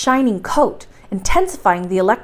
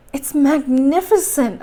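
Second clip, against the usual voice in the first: higher, slow cry of wonder; spontaneous cry; 4 semitones higher than usual. A narrator's reading. Axel seeing the glittering cave, crying out in wonder. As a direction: draw it out in awed delight. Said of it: "I cried spontaneously".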